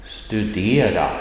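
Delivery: "Studera" with the tone going up on "de"; rising tone accent. Shown as a demonstration. Teacher repeats the accent one example, rising tone on "Studera". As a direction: lift the pitch on the middle of "Studera". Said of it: "It goes up".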